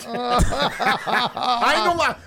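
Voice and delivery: loud, high protest through laughter; laughing insistence. Real, not acted. Comic swearing his story is true, laughing. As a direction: stretch the words out, cracking up.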